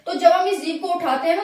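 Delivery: normal talking voice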